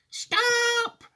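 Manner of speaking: put-on very high, drawn-out old lady's yell. A narrator's reading. Grandma yelling over the shrieking wind, very high. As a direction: cry out in high-pitched alarm. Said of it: "yelled Grandma Josephine".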